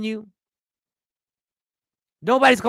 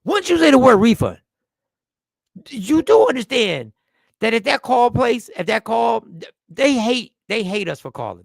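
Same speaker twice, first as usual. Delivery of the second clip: loud, raised-pitch warning; exasperated outburst; 3 semitones higher, 6 dB louder than usual. Real, not acted. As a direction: burst out emphatically to drive the warning home. Sudden outburst warning about call center.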